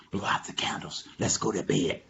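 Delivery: soft whispering; hushed bedtime voice. Acted. Animals settle for bed, soft whispered instruction. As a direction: whisper it as if lights go out.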